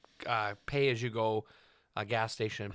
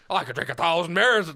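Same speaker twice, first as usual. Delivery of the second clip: high, loud garbled bragging; loud boastful impression; 5 semitones higher, 9 dB louder than usual. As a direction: bellow the drunken-sounding boast. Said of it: "I was like".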